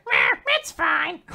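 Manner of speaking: high, drawn-out cartoon squawk; silly creature voice. Little collar face giving Andy advice, silly high voice. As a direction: squeak it out like a little talking collar. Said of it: "I don't know what voice Clothy has".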